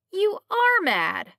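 high, loud and slow, scoffing at him; loud disbelieving exclamation; incredulous outburst. A narrator's reading. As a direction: blurt out that he's mad, half laughing. Derville scoffs at Elvin's hunch.

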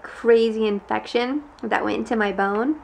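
ordinary delivery

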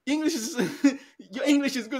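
high, laughing, condescending praise; patronizing compliment. Retold or imitated. Mimicking a native speaker praising a migrant's English, high and laughing. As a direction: talk down with a mock-sweet laugh. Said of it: "It's like".

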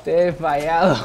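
mock dramatic lament. Apologizes to fallen teammate.

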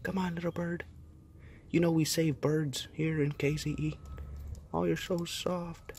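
high soft coaxing voice; coaxing a wild bird. Real, not acted. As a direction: coo gently to the little bird.

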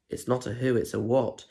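usual speaking voice

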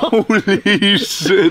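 said through laughter, drawn out; laughing. Real, not acted. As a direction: laugh while getting the words out. Laughing at the referee clip.

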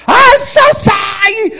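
very high, slow, loud wail; exaggerated squalling sob-shout; mock crying. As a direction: bawl it out, stretching the words. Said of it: "sitting over there on a little divan crying".

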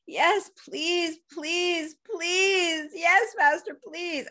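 high, urgent pleading; eager begging. Retold or imitated. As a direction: beg desperately, acting it out. Begging master in retold scene.

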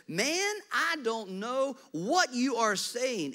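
high, loud, slow protest; Peter, cornered, denying a third time; Peter's third denial. Acted. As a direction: deny it outright.

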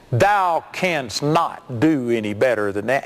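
slow, commandment-style taunt; mock put-down. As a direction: belittle him as if handing down law. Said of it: "he was putting me down".

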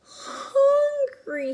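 high, crying whimper. Acted. Starving dragon sobbing on the ground, high crying whimper. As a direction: wail it like a sad hungry dragon. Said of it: "he whimpered".

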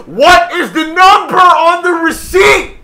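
slow, very high, very loud demand; full shout. Retold or imitated. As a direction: yell each word over a shouting customer. Shouts back over yelling customer.

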